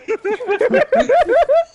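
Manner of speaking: cracking up helplessly; high laughter. Real, not acted. Recalling waving at the man again, laughing hard.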